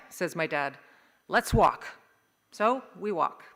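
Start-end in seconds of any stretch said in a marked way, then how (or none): 1.3-1.9 loud, short order; commanding dad. Acted. Dad to family in the snow, loudly decisive. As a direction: give a firm marching order.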